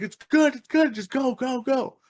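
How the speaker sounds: high, hurried-sounding reassurance; urgent placating. Retold or imitated. Brother stopping the bouncers, urgently placating. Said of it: "my brother had to stop him".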